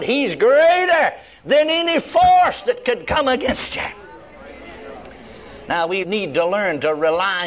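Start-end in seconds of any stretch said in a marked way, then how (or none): 0.0-3.8 higher, building sermon climax; impassioned preaching. Real, not acted. Preacher assuring believers of God's power, impassioned. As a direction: drive it upward with full conviction.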